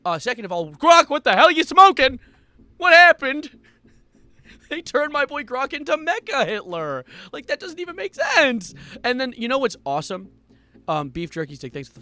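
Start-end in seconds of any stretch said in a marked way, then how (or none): 0.7-3.6 high, loud what-the-hell shout; exasperated yell. Real, not acted. Streamer to Grok, exasperated yell. Yelling at the chatbot. 4.7-8.7 high-pitched disbelief; incredulous rant. Real, not acted. Streamer mourning his chatbot, high incredulous rant.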